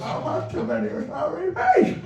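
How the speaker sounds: laughing, slurred and high, as if his mouth is bone dry. Acted. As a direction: mangle the words with a dry tongue and gums. Dry-mouthed slurred speech, acted.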